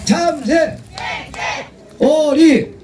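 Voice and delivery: high shouted animal names; game calls. Acted. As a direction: fire off each call up high. Call and response game.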